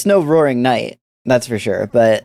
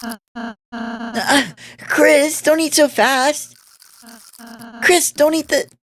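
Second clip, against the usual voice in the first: put-on high, worried young girl; 10 semitones higher, much slower than usual. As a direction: fret in a high girlish voice. Girl character fretting over Chris.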